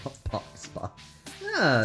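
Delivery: usual speaking voice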